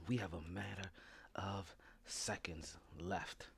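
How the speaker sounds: hushed whisper before going live; whispering as the show is about to start. Real, not acted. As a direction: whisper it, seconds to air.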